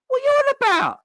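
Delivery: can't believe what he just heard; incredulous outburst. Real, not acted. Fan venting at the manager's interview, high incredulous cry. Fan's exasperated retort to Klopp.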